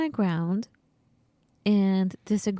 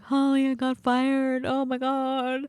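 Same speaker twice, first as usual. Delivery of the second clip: higher, slow whiny fear; hammed-up frightened call to a friend; mock panic; 5 semitones higher, slower than usual. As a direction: play up being scared to a friend. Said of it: "I'm like".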